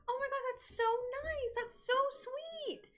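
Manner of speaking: retelling her thrilled reaction to a free candle; gushing. Retold or imitated. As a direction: squeal over a sweet gift.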